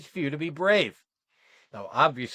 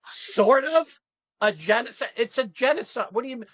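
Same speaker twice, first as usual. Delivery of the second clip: high, loud disbelieving questions; incredulous outrage; 11 semitones higher, 5 dB louder than usual. Real, not acted. Coach reacting to people hedging about genocide, incredulous. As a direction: challenge the hedge, appalled, pitch jumping up. Outraged answer to hedged quote.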